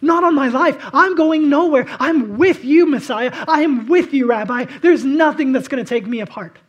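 Peter's loud vow. Retold or imitated. Preacher voicing Peter's loud vow to Jesus. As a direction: swear it loud to the Messiah.

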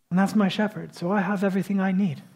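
usual speaking voice

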